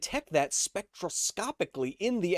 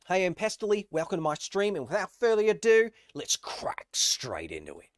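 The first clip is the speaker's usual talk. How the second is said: put-on Aussie streamer voice; Pestily; 4 semitones higher than usual. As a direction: open the stream in a big Australian accent. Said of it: "I get my Australian accent from Pestily".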